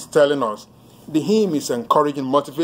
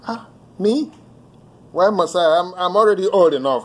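higher-pitched imitation of a taken-aback objector; incredulous protest; 5 semitones higher than usual. Ruler answering the master's demand, mock incredulous protest. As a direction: act out a startled, defensive protest.

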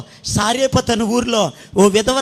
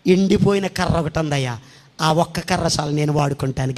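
lower, softer humble reply; humble Moses; 9 semitones lower, 6 dB quieter than usual. Acted. Moses meekly answers God, low and soft. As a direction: offer it up meekly, head bowed. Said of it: "మోషే అంటున్నాడు".

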